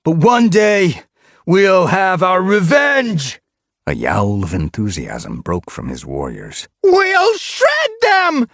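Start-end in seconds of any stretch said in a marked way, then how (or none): 0.0-3.4 slow, much higher and louder vow; rallying shout. A narrator's reading. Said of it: "raised his voice". 6.8-8.5 very high, slow and loud wail; caterwaul. A narrator's reading.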